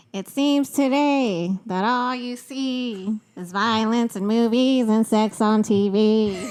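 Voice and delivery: loud, slow sung cartoon mom; Lois Griffin. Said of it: "You sound like her".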